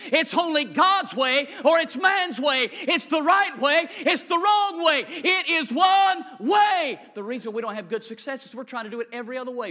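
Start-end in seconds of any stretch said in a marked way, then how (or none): 0.1-7.0 loud, slow, pounding each way; preaching shout. Real, not acted. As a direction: hammer it from the pulpit, high and loud. Preacher hammering a point home.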